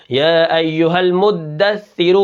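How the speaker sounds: المدثر with the shadda clearly held; slow careful المدثر; doubled dal. Shown as a demonstration. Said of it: "جیسے". (المدثر میں تشدید کے ساتھ)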